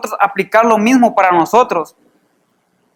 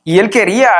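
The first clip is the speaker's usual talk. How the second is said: ordinary delivery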